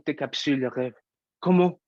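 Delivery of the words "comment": high, loud burst of a question; desperate outcry. Acted. Performer demanding how to stop it all, desperate outcry. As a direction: cry it out at the breaking point.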